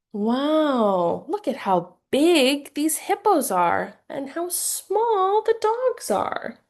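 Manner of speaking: high, slow, amazed; wide-eyed, slow exclamation; wonder for a child. Shown as a demonstration. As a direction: wow them with how big and small. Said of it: "you can point out things like size".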